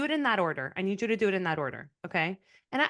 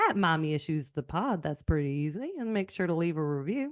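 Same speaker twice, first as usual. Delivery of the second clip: easy Southern sign-off; Southern accent. Shown as a demonstration. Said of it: "in your Southern accent, accent, why don't you sign us off?".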